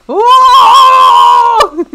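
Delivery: loud shocked whoa; startled shout. Real, not acted. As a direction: yell out in fright. Startled in horror game.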